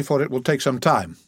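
ordinary delivery, nothing marked

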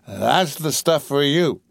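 slow, relishing exultation; tipsy, savoring cheer; exultant cry. A narrator's reading. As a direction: crow it with drawn-out delight. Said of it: "cried Master Freddy exultantly".

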